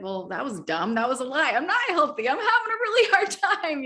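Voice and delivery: from dismissive mutter rising to high laughing despair; scoff, then break into an exasperated laugh; rising frustrated laugh. Retold or imitated. Retelling how she deleted her first recording, building to a laugh. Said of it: "And I said".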